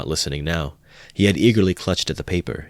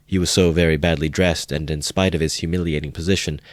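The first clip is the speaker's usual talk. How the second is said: usual speaking voice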